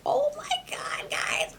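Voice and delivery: high breathy gasp of amazement; amazed whisper. Real, not acted. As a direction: gasp it out, stunned. Tasting mushroom, amazed.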